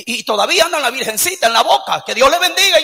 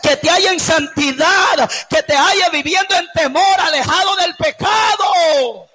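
loud, impassioned sermon delivery; fiery preaching; 2 semitones higher, 4 dB louder than usual. Acted. Pastor preaching hard to the church, impassioned shout. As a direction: preach it with fire to the congregation.

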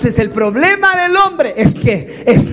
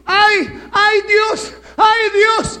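high, loud cry of alarm; panicked cry; 10 semitones higher, 4 dB louder, slower than usual. Retold or imitated. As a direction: cry out to God in sudden panic. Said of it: "y dijo". (grito de pánico de la profesora al desmayarse)

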